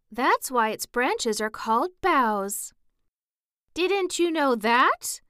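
high loud eager crying out; daisy crying out. Acted. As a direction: pipe it up loud and cheeky. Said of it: "cried another daisy".

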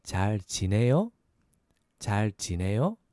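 잘 지내요 going up at the end; rising question tune. Shown as a demonstration. Teacher asking "how are you", rising at the end. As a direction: lift the last syllable of 잘 지내요. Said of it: "go up at the end".

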